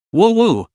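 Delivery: high sobbing woo woo; mock crying wail. A narrator's reading. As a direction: wail like a scared kid. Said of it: "so frightened he began to cry".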